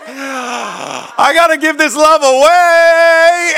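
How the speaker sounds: very high, loud and very slow, stretching every word; hammed-up stretched-out ecstasy; drawn-out rapturous shout. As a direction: belt it out like a revival cry. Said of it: "You were like".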